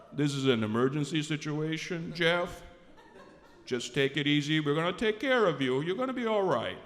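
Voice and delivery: low, measured doctor voice; calm the patient in a deep, steady voice; imitated reassuring doctor. Doctor's reply in the retold scene.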